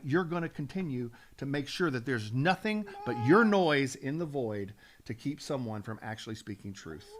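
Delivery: usual speaking voice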